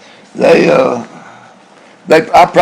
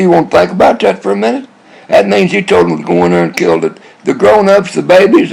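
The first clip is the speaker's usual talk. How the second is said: usual speaking voice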